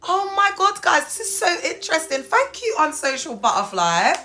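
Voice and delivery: high-pitched, excited gushing; thrilled squeal of wonder; amazed delight. Real, not acted. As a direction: gush your thanks high and loud. Amazed, thanks the viewer.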